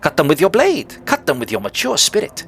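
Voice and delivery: high, swooping, theatrical critter; eager creature Nu. Acted. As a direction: urge on a hunter in excitable creature tones. Voices the creature Nu.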